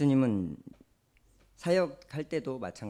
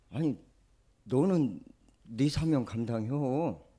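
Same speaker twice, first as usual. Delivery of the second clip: Jeolla dialect rebuke; 4 semitones higher, 6 dB louder, slower than usual. Acted. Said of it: "전라도 말 써서 죄송합니다".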